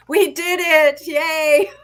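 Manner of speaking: high, loud celebratory whoop; excited cheer. Real, not acted. Host celebrates getting the guest on at last, excited cheer. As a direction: cheer in relief, breaking into a laugh.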